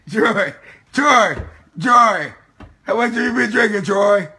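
loud calling. Real, not acted. Yelling at drunk friend Troy, loud. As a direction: shout to wake him.

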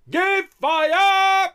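very high, loud officer's scream; full-throated high battlefield cry; screamed command. A narrator's reading. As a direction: scream out the order to the gunners. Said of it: "the British artillery officer screamed".

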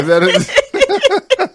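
high giggly question; laughing falsetto. Pretend. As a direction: squeak it out through laughter. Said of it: "You sound like you a damn fool".

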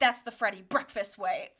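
low, put-on deadpan correction; Freddie impression. Host voicing Freddie's comeback to Carly, low and put-on. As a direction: drop low and state it like a motto. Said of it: "And he goes".

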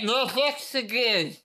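slow numb-mouthed mumble; Roz voice. Retold or imitated. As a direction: drag it out like a numbed mouth. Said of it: "Well, she would say".

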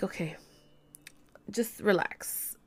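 quiet, slow words to oneself; retold self-soothing. Caller telling herself to relax, quietly reassuring. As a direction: imitate settling yourself, softly and slowly.

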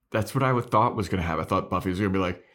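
ordinary delivery

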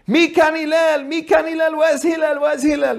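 high, insistent mock shout; retold screaming. Bettor back outside the bathhouse, high mock screaming. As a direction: holler for him over and over, imitating the pest. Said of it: "then starts screaming again".

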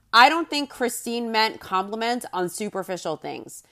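ordinary talk

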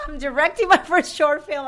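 high, loud, bursting announcement; excited announcement. Retold or imitated. Telling James she is directing her first film, high and loud with excitement. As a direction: blurt it out, thrilled. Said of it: "and I said".

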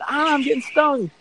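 high imitated wail, no real volume; mock screaming. Laughing friend suddenly stung by jellyfish, mock screaming. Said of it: "Scott's screaming".

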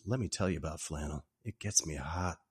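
low, soft retold seduction; sultry come-on. Neighbor flirting with Jack about flannel, low and sultry.

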